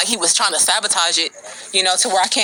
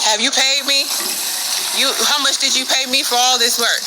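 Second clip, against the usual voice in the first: mocking; 6 semitones higher than usual. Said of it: "He was like".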